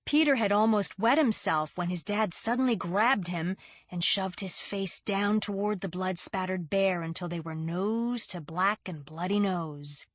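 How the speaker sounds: ordinary delivery, nothing marked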